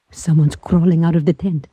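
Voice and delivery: normal talking voice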